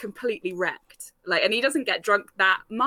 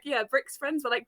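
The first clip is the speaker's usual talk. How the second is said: normal talking voice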